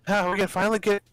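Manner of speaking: bright, high heroine voice; a plucky game heroine, eager; character voice. Acted.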